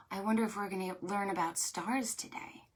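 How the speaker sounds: ordinary delivery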